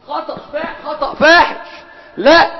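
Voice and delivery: high-pitched, exclaimed correction; emphatic correction. Real, not acted. Teacher rejects a student's wrong answer, emphatic and high. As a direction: pitch leaps up, landing hard on فاحش.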